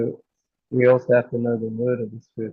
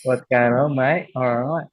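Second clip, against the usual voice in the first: imitated broad Aussie "mate" talk; casual working-class Australian accent. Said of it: "Like this, you know".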